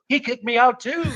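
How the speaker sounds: high, mock-indignant punchline; Shatner. Diner telling of a second sushi chef's rejection, indignant. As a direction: pitch it up in exaggerated disbelief. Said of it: "He goes".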